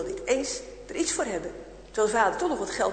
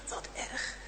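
shocked whisper; 10 dB quieter than usual. Acted. Wife shocked at the news.

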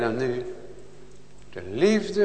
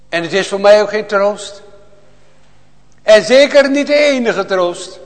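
loud, high, impassioned preaching; fiery preaching; 6 semitones higher, 11 dB louder than usual. Acted. Preacher to his congregation, a loud fervent cry. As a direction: proclaim it to the whole church with full fire.

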